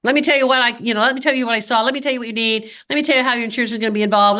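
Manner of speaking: fast, pushy "let me tell you" rattled off; rushed pushy pitch. Shown as a demonstration. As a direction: rush it like a salesman cutting the patient off. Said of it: "I'm going to slow down".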